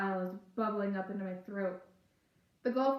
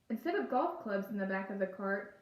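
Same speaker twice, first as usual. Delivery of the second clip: plain reading voice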